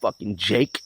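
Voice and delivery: ordinary talk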